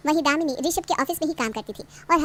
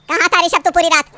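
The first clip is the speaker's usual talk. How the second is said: angry demand; 8 dB louder than usual. Acted. Mother demands where son was.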